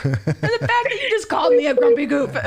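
put-on high, whiny teenage pout through laughter; a hammed-up whine of a grumpy kid; mock whiny teen. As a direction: play the sulky teen, laughing. Mocking a sulky teen.

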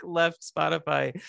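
ordinary talk

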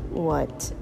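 ordinary talk

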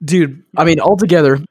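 words stretched by a yawn. Real, not acted. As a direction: talk through a big yawn. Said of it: "my God, I'm yawning".

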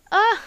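a sudden high cry, half laugh and half sob; sharp tearful cry. Real, not acted. Alone on camera, she cries out through tears. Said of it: "Stop fucking crying like a".

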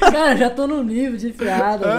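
laughing while speaking; chuckling through it. Real, not acted.

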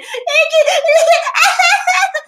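very high, loud mock shrieking laugh; imitated laughing fit. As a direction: imitate hysterical, drawn-out laughter. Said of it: "Y se reía". (imitando una carcajada aguda y fuerte)

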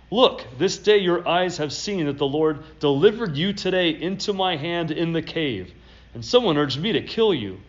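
scripture reading. Acted. Pastor reads David's plea to Saul from scripture, declaiming. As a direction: read it out with conviction.

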